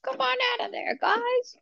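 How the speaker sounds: high, sing-song coaxing. Pretend. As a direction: coax them out in a high voice. Coaxing beans from water.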